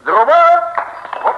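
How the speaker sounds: loud, high battle cry; a cheerful call to action; rallying shout. Acted. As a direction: rouse the others to go.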